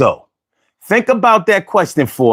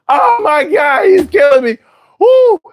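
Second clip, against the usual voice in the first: real laughing yells; laughing shout; 10 semitones higher than usual. Real, not acted. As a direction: cry out as the joke kills you. Cracking up at the comment.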